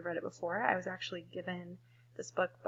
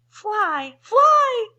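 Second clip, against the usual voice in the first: very high, loud alarm call; 13 semitones higher, 15 dB louder than usual. Acted. Birds call to the bees, high loud alarm. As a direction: call a warning across the field. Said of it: "called the birds to the bees".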